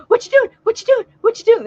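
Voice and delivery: high, loud squawky parrot chant. Retold or imitated. Imitating her excited parrot at the drive-through, high squawk. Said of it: "she'd go".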